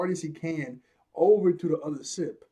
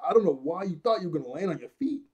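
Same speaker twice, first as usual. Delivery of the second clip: ordinary talk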